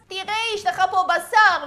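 ordinary delivery

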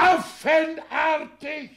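very high, loud, slow outraged cry; indignant shout. Acted. Father shouting back at his son's accusation.

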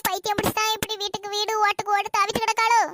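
normal talking voice

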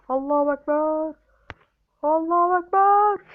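building desperate shout; rising cry. Real, not acted. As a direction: shout it as a last-ditch plea. Desperate attempt at jump.

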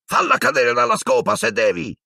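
high, far-off character voice calling from the stands; shouted order. Acted. A teammate urging Harry mid-match, high shout. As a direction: yell it up at the flyer.

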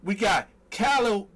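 big up each name and city, stretching place names; hype roll call. Real, not acted. Host shouting out live viewers by hometown, hyped roll call.

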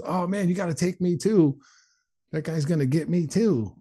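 high, panicky whine of a scared accomplice; imitating frightened pleading. Scared friend begs guards.

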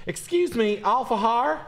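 high, loud, sugary neighbour voice; mock a prim Southern lady asking nicely; Paula Deen type lady. Mocking decor, a high hammed-up Southern lady voice. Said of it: "Paula Deen woman move out to be like".

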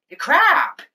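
a sudden high mocking insult; ranting jab. Real, not acted. Ranting at actor.

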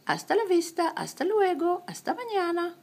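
high, sing-song goodbyes. Acted.

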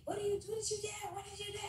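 very high, fast alarmed mother; alarmed mom. Retold or imitated. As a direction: mom panicking at the news. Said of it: "she's like".

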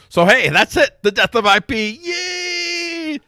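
high, loud mock cheer; a hammed-up victory cry, rising to a squeal; mock triumph. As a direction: celebrate in gleeful mock triumph. Mock celebration of IP death.

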